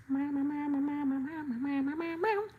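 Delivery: put-on high baby babbling mama. Voicing Bam Bam as a baby, high babbling. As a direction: babble like a toddler.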